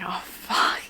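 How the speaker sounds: breathless whispered oh fuck; shocked whisper. Retold or imitated. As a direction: whisper it in disbelief. Stunned reaction to good news.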